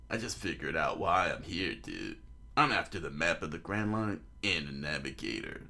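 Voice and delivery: low, laid-back dude voice; Luffy. Acted. Boy tells old man his plan, low laid-back voice. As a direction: drop low and answer casually.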